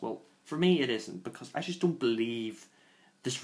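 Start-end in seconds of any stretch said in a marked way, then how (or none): none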